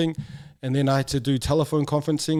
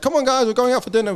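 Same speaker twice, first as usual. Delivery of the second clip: higher, quick cheerful mom voice; mom calling; 10 semitones higher, 3 dB louder, faster than usual. Retold or imitated. Mom calling the family out to dinner, cheery. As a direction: brightly round up the kids.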